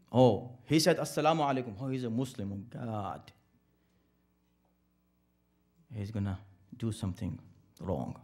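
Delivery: a fearful onlooker, fading to a hushed worry; imitating a fearful onlooker. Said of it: "what kind of picture will come in your mind".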